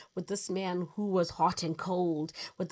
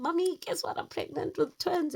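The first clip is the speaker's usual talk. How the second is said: high, eager announcement; excited news; 12 semitones higher, 4 dB louder than usual. Retold or imitated. Daughter phoning her mom, high and excited. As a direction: burst out the big news.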